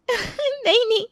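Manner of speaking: slow, giggling no; laughing. Acted. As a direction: laugh through the denial. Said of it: "और तू हंस क्यों रही है". (हँसते हुए नहीं कहना)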